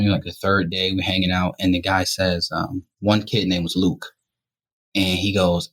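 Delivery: ordinary delivery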